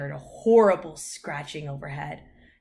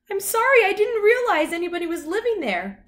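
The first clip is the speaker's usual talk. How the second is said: very high, loud dog voice; high, eager talking dog; apologetic dog; 8 dB louder than usual. A narrator's reading. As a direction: apologise in a high-pitched puppyish voice.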